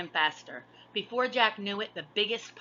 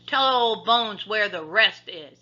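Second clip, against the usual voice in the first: slow, louder pirate threat; growl; 4 semitones higher, 8 dB louder, slower than usual. A narrator's reading. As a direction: growl it slowly. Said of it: "he growled".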